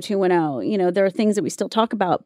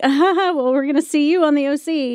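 higher-pitched mock-giggly rival voice; giggly taunt; 7 semitones higher than usual. Rival girls to the actress passing in the hallway, giggly taunt. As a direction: imitate smug girls laughing as they say it. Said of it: "giggling about it and".

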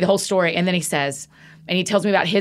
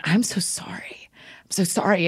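soft, slow, near-whispered apology; choked up; 6 dB quieter, slower than usual. Retold or imitated. Apologizing to her friend at Frothy Monkey, choked up. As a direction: apologize with a tight, choked throat. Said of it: "I just got so choked up".

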